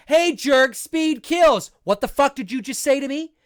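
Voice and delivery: loud, high mock yell at a driver; hammed-up threat from the car; mock furious driver; mock road rage. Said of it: "And that's like".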